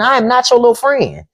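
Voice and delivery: put-on high, firm old-school parent line; mock-scold like a parent setting a child straight; imitated parent scolding. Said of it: "what our parents used to say".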